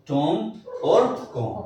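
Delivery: टॉम और कॉम said slowly with the b silent, like tom and com; silent b pronunciation. Shown as a demonstration. Teacher to pupils, slowly showing the silent b. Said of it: "बी silent है दोनों का".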